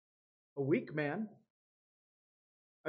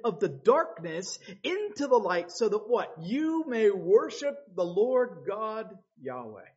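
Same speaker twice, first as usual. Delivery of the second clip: louder, higher preaching build; full-force preaching; 6 semitones higher, 5 dB louder than usual. Acted. Preacher rousing the congregation, loud and rising. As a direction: drive the point home to the congregation.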